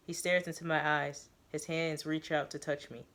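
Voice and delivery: ordinary delivery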